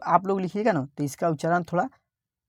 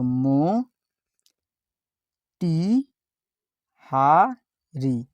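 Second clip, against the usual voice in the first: slow syllable by syllable; much slower than usual. Shown as a demonstration. Teacher sounding out a word for students, very slow syllables. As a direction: sound out मो टी हा री slowly, one syllable at a time. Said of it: "टी के जगह पर हम ट लिखते हैं".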